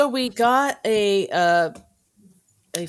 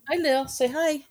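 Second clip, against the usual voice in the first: high, softer pet-talk voice; dog talk; 7 semitones higher, 4 dB quieter than usual. Real, not acted. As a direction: coax the dog sweetly. Talking to the dog.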